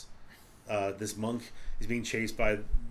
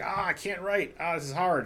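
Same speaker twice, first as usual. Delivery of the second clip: whiny writer; 6 semitones higher than usual. Retold or imitated. Mocking the whiny writer heroine.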